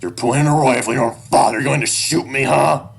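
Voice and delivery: heated, goading shout; angry challenge. A narrator's reading. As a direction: dare your child at full voice. Father challenging armed child.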